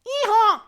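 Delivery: high, blasting yee-haw; very loud donkey bray. Acted. Donkey Azola startles villagers from behind, very loud bray. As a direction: bray at full volume to startle. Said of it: "So loud".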